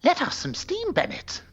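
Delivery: put-on movie quote; action-film one-liner. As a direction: throw out a hammed-up action pun. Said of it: "he could have just said".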